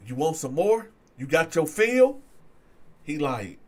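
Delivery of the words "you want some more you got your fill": loud taunting questions; retold challenge, louder toward the end; challenging. Winner to the man coming to, challenging.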